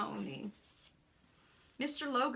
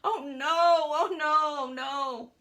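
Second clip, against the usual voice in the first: loud, drawn-out acted moans; moaning; 3 semitones higher, 8 dB louder, slower than usual. Man under the cart to his rescuer, loud moaning. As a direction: wail it slowly, then ease off. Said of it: "continued moaning".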